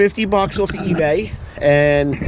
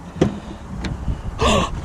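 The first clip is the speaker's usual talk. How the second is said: mock surprised gasp; 4 semitones higher, 6 dB quieter than usual. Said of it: "besides the cheesy joke".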